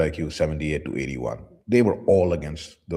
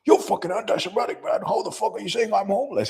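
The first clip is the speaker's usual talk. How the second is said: much higher, whiny offended rant; mock indignant outrage; faster than usual. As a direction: play the offended man, squeaky and indignant. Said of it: "He's like".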